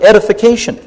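"edification" lifted high and punched loud; stress on edification. Real, not acted. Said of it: "I want to emphasize that word".

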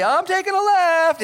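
high, loud, drawn-out cry; mock defiant outburst. People shown the blueprint veer off course, mock loud cry. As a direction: call it out high and slow, hammed-up.

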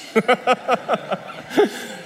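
a light, high laugh at his own joke; breathy laugh. Real, not acted. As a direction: let a short laugh slip out.

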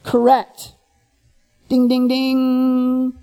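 slow sing-song ding ding ding like a quiz show bell; game-show host. Retold or imitated. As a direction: announce the right answer like a game show. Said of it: "And then Jesus drops".

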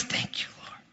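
breathy whispered prayer; whispered thanks. Retold or imitated. Pastor whispering thanks to the Lord. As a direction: whisper it to God.

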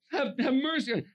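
high, loud acted plea; urgent high shout; calling out for mercy. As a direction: cry out over the crowd. Re-enacts blind man calling out.

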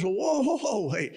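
high, startled whoa from Moses; alarmed protest. Retold or imitated. Moses protesting to God, high and startled. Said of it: "So Moses says".

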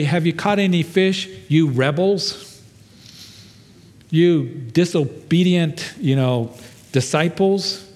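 mock harsh scolding. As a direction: scold them as rebels and cowards. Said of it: "He didn't say".

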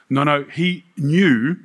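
ordinary talk

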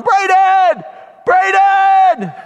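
high, loud, drawn-out shout of a name; yelling for son. Retold or imitated. Father searching the campground for his missing son, yelling. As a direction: call out desperately across a campground. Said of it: "running around the campground yelling".

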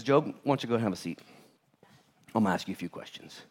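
a hint of a calm, commanding God settling Job down; God's voice. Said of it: "God looks at Job and says".

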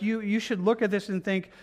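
usual speaking voice

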